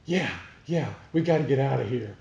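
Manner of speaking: a lightly voiced eager barfly; eager agreement. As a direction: hurry them out the door, keen to go. Joke character agrees eagerly.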